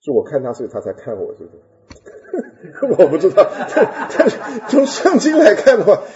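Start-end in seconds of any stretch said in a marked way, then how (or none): 2.0-6.0 laughing. Real, not acted. Laughing at own story.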